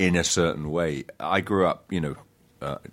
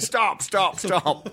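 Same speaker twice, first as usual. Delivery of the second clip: high-pitched mock call to stop, repeated; lightly imitating the director cutting him off mid-audition; a playful, raised retelling of being halted; retold director stopping him; much slower than usual. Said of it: "and he just went".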